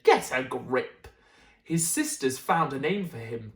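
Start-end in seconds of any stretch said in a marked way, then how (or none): 0.0-1.1 slow, low no-nonsense dad voice; a lightly voiced gruff father; stern dad. Dad telling mum to calm down, low and slow. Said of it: "and Dad said".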